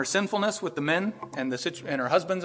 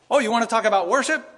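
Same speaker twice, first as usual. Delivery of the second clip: knowing retort; 7 semitones higher, 8 dB louder than usual. Retold or imitated. Jesus turning her words back.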